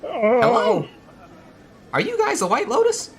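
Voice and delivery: very high, loud put-on greeter voice; eager falsetto hail across a distance; Cap. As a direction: call out in a high, strained character voice. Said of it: "let's see if I can do his voice".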